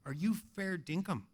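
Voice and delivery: soft, hushed Australian query; tentative and low in volume; quiet wary question. Retold or imitated. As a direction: ask it softly, unsure of the offer. Man's first, hesitant wary question.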